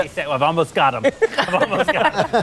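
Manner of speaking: Jason Campbell. Retold or imitated. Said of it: "Jason Campbell is sitting at his laptop going".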